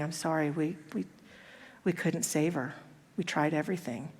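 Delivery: soft, low, halting condolence; sorrowful doctor. Retold or imitated. Doctor breaking news of a death.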